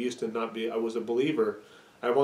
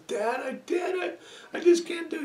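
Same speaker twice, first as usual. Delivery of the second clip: put-on whiny little boy. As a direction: sound small and defeated. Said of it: "He says".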